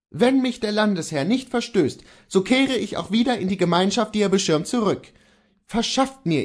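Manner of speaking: a defiant man holds his ground; Kohlhaas voice. Acted.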